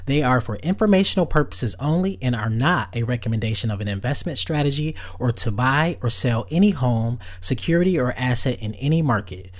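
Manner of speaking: loud, rushed disclaimer read; fast legal disclaimer. Acted. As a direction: rattle off the fine print.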